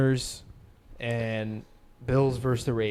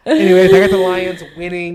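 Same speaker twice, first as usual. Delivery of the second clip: loud; 6 semitones higher, 13 dB louder than usual. Real, not acted. Loud reset after joke.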